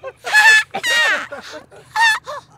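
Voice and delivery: very high, squealing imitated giggle; tiny baby giggles. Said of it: "I would have the tiniest little giggles".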